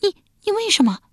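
high, stammering cry of horror; shocked cry. Acted. Wife horrified by her husband's plan, high shocked cry. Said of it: "亚子惊叫一声道".

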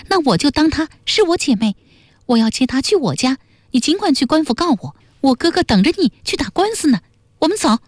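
put-on high, rapid, indignant young voice; high-pitched righteous indignation; angry. A narrator's reading. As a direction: fire off the defiance quickly in a high voice. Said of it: "半儿脸也气得发白说".

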